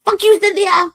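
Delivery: high, loud mock hater voice; sneering imitation of angry commenters; mocking internet haters. Cynthia mocking angry internet commenters, high and sneering. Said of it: "They're like".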